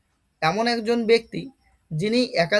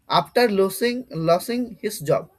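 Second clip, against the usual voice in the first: slow dictation; much slower than usual. Shown as a demonstration. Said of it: "slow reading of example".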